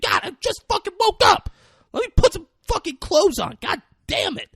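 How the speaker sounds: very high, whiny mock protest; hammed-up teenage exasperation; acted exasperated son. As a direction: squeal in protest at a parent. Voicing exasperated son to dad.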